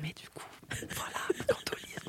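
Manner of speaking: very soft unvoiced whisper. Real, not acted. Whispering the term back to her co-host. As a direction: barely breathe the word.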